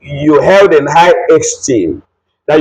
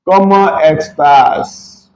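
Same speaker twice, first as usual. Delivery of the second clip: slow demo; much slower than usual. Shown as a demonstration. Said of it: "This is a question".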